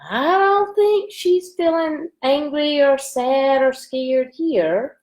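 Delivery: high, slow and wondering; playful wonder. Real, not acted. Teacher to children about the calm girl, playful wonder.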